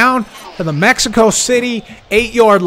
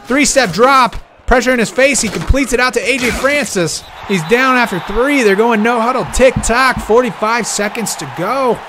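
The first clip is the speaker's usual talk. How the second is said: excited play-by-play; 6 semitones higher than usual. Real, not acted. Tense final drive call.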